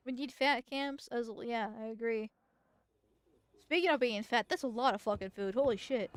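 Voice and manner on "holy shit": normal talking voice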